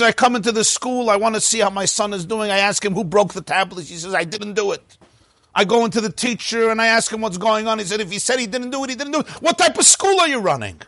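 high, exasperated rant climbing in pitch; furious father. Acted. Angry father complaining to the principal, high-pitched exasperated rant. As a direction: complain breathlessly upward to an outraged finish. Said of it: "the father is furious".

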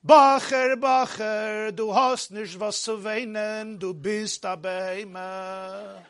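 slow, drawn-out Jerusalem Yiddish singsong; Jerusalem melody. Retold or imitated. Maggid consoles the weeping bochur, Jerusalem melody. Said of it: "a Jerusalem melody".

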